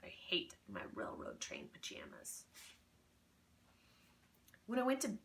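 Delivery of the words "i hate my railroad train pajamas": grumbling. A narrator's reading. Low grumbled complaint.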